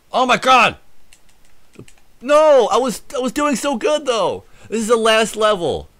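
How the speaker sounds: high, loud cry of dismay; frustrated shout. Real, not acted. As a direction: yell out in gamer rage. Died on last level.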